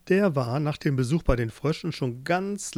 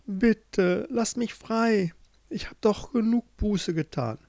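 higher-pitched, drawn-out begging; pleading; 7 semitones higher than usual. Acted. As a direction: plead to be set free. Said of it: "Jorinde flehte". (flehend, mit hoher Stimme)

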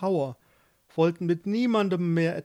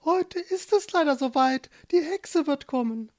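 high, cooing bird voice; dove voice; 11 semitones higher, 4 dB louder than usual. Acted. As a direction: speak like a fretful little dove. Doves voiced in story.

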